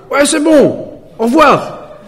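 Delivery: voix du voisin, bien plus aiguë et sèche; congédier l'intrus d'un ton méprisant. Retold or imitated. Neighbour brushes him off.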